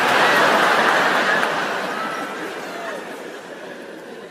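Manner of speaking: breathless squeal of laughter; high airy laugh. Real, not acted. Comedian cracking up at the punchline, high airy laugh. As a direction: crack up silently.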